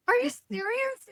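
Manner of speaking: very high retold disbelief; incredulous. Daughter questioning mom's silence, high-pitched incredulity. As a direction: pitch it way up in mock astonishment. Acts out her disbelief at mom.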